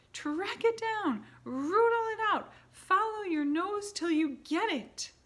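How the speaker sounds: very high, loud scream; screaming order. A narrator's reading. Grand High Witch screaming at her witches to hunt. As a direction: scream the command.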